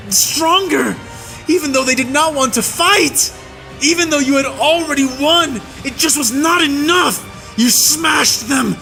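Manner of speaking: high shouting, cracking with anguish at the peaks; a soldier screaming out what he witnessed; anguished rage. A narrator's reading. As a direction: let grief and fury break through the yell. Tirade over remembered horrors.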